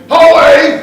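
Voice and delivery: loud, high Geordie "Hoey!"; imitated Geordie call. Imitating the bride calling the dog, loud Geordie. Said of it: "I caught her the other day saying".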